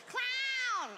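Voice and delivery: drawn-out, very high mock yowl; cat-being-assaulted yell. Old woman heckles the comic, cat-like mock screech. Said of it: "It sounded like a cat being assaulted".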